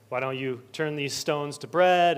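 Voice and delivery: ordinary delivery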